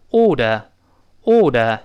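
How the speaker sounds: British "order" without either r; slow British "order", aw-duh; British pronunciation. Shown as a demonstration. Teacher showing the easier way to read it, British pronunciation. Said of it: "但是英式呢，发音这个发音好读".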